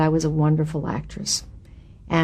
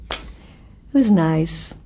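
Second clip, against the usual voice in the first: ordinary talk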